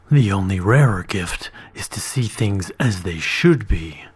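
low hushed whisper; whispered wisdom. A narrator's reading. Dragon whispering a lesson to the boy. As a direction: breathe it like a secret truth.

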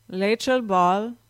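slow, reedy, hollow otherworldly clerk; drawing out Rachel Ball, reedy and not quite human; strange reedy voice. Acted. Ghostly clerk greets Rachel on the phone, slow and reedy. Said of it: "said the strange, reedy voice".

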